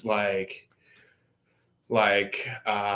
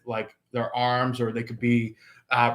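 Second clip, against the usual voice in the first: normal talking voice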